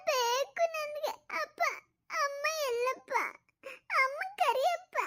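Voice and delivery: put-on high, slow tearful little boy calling for his mother; little boy sobbing for his mom; crying child. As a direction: whimper Amma like a lost small child.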